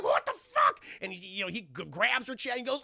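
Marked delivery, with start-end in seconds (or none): 0.0-0.8 high, loud mock shriek; acted alarm. Anna is chased by zombie Lewis, mock alarm. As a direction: yelp it in panicked disbelief.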